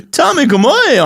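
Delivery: "Tommy Gomoy" said high and slow; Irish phrase. Shown as a demonstration. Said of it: "could you aim or a tattoo".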